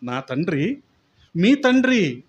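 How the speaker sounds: loud, driving sermon climax; preaching at full force. Acted. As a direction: proclaim it to the church, building in force. (బలంగా, ఉద్వేగంగా ప్రసంగించడం)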